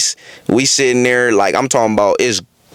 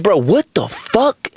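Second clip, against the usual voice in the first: slow, higher-pitched disbelief; flabbergasted; 5 semitones higher, slower than usual. Retold or imitated. Sitting there after she lay down, flabbergasted. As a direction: draw it out, stunned. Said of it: "I'm just sitting there flabbergasted".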